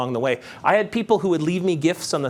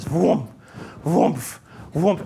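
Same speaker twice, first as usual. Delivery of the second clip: short whooshing vrooms with pauses between; imitated car vrooms; 2 semitones higher, much slower than usual. Speaker shows audience the highway traffic, imitated vrooms. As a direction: mimic cars whizzing past, one by one.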